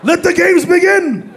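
high, slow, ringing shout; triumphant announcement. Acted. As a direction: proclaim it like opening a contest.

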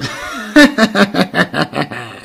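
shouting evil cackle; villain laugh. Acted. Grinch cackles over his stolen loot, shouting.

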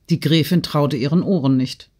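ordinary delivery, nothing marked

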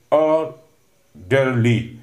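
"Orderly" drawn out in two slow beats; split into syllables. Shown as a demonstration. Teacher showing students the syllables, "Orderly" said slowly in parts. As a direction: break "Orderly" into syllables with a pause between. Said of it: "demarcate the following words into syllables".

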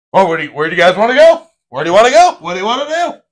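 fast, high, loud pestering friend; impatient Noah. Retold or imitated. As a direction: nag them about plans, quick and eager. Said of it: "be like".

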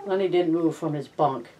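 plain reading voice

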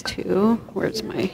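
ordinary talk, nothing marked

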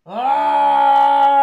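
long, loud high scream; rage scream. Pretend. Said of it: "scream with rage".

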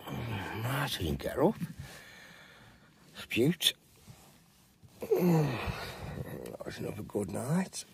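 soft, low, half-whispered; hushed morning voice. Real, not acted. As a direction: keep it down so nobody wakes. Early morning, others still asleep.

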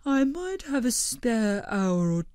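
gently astonished; Blobbert's voice. A narrator's reading. Blobbert excuses himself.

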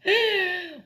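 high, wild witch cackle; sudden cackle from the dark. Acted. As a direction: cackle like an old witch behind her. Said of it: "she hears a cackle".

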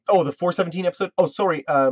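ordinary talk

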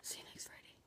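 soft whispered goodbye; whispering. Real, not acted. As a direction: whisper the farewell. Whispered sign-off.

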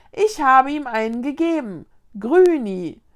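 loud, proud announcement; calling out. A narrator's reading. Linus announces the new name to ghosts, calling out. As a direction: announce it to the crowd. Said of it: "rief Linus zu den Geistern".

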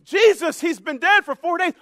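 loud, high mock protest; lecturing Martha. Martha trying to give Jesus a lesson, loud mock protest. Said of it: "are you trying to give Jesus a lesson".

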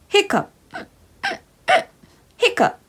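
high little hiccup sounds. Acted. Reader acts out hiccups for the children, high.

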